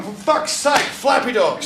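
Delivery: high giddy exasperation. Retold or imitated. Exasperated patient about flappy dogs.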